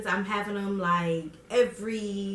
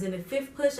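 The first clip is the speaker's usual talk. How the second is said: ordinary talk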